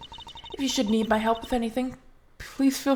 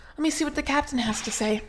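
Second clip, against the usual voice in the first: ordinary talk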